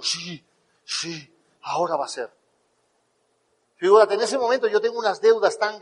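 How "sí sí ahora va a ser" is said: bajito y grave, casi para sí; asentimiento quedo actuado. Retold or imitated. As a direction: repite convencido en voz baja. Se responde embobado, en voz baja. (convicción susurrante fingida)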